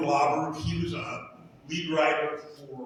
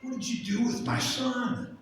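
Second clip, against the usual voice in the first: high pleading lament; imitating crying God; 10 semitones higher than usual. God calling to the people over his son, mock-crying. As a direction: mimic a grieving father crying out, pitched up. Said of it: "he comes out and he's crying".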